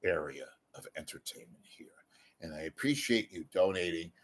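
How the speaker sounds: soft confiding whisper; hushed aside to viewers. Real, not acted. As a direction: lean in and whisper it. Drops to a whisper.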